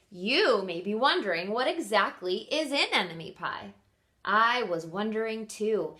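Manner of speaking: expressive read-aloud for kindergartners; story reading. Acted. Teacher reading Enemy Pie to her class, lively storytelling. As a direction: read it like story time on the rug. Reads picture book aloud.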